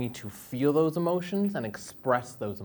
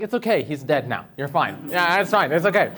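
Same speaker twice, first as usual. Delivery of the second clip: breezy reassurance; 4 semitones higher, 6 dB louder than usual. Retold or imitated. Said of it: "And I'm like".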